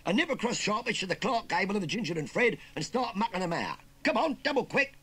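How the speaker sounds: loud, high-pitched threat; menacing. Acted. Nephew bullying his astounded uncle, menacing. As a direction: bite back loud and threatening. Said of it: "said Pickles menacingly".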